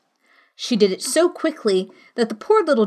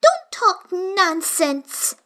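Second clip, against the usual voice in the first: bold; 8 semitones higher, much slower than usual. A narrator's reading. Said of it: "said Alice more boldly".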